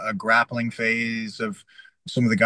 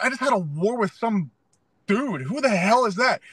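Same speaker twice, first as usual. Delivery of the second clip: high, fired-up protest; worked-up disbelief; 10 semitones higher than usual. Retold or imitated. Fighter to coach about sparring partner, high fired-up protest. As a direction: still buzzing from the war. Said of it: "I'm like".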